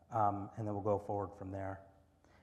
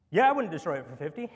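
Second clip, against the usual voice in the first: quick eager yes; 4 semitones higher, 10 dB louder, faster than usual. Acted. Lord voiced answering quickly.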